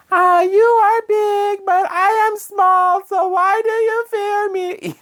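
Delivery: put-on very high, slow, eerie little-girl singing. Reviewer imitates the eerie child on the track, very high and slow. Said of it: "features a little girl's voice".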